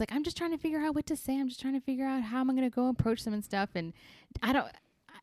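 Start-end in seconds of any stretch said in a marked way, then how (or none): 0.1-3.7 high and fast, a flustered mock Jen; nervous Jen quote. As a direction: rattle it off high like a nervous girl. Friend quoting Jen's nervous words.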